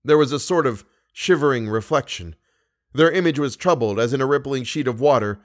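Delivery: ordinary delivery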